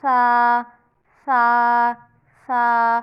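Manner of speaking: सा said loud and clear, tongue between the teeth; correct saa sound. Shown as a demonstration. Teacher demonstrating the right सा aloud for practice. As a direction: hold सा out clearly, tongue under upper teeth. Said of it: "तो ऐसे पढ़ा जाता है".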